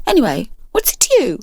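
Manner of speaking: high, sharp challenge; bristling girl. Acted. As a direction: hands on hips.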